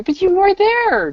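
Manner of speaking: high, slow mock indignation; high mock outrage. Real, not acted. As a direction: squeal it in fake shock. Mock accusation in banter.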